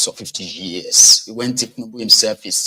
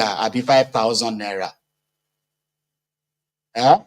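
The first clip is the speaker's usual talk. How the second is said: normal talking voice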